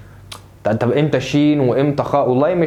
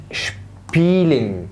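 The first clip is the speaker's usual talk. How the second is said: "شبيلين" drawn out slowly in two parts; two separate syllables; much slower than usual. Shown as a demonstration. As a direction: split "شبيلين" after a long first syllable, sh before p. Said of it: "خلي بالك في مقطعين". (شبيلين ببطء على مقطعين)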